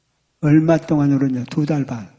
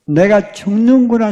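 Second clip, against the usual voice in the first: higher, louder and slow; anguished cry; 6 semitones higher, 6 dB louder than usual. Retold or imitated. Wife realizing she will die, crying out higher and louder. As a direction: cry it out in shock.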